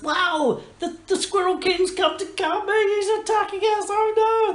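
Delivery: very high, loud squealing alarm; panicked townsfolk. Acted. Game master plays townsfolk fleeing an attack, high-pitched panic. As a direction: cry out in hammed-up terror. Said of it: "They're running around like".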